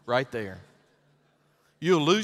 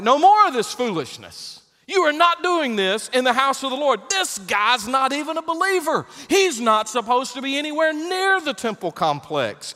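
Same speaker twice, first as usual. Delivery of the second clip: indignant; 9 semitones higher, 5 dB louder than usual. Retold or imitated. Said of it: "I'm like".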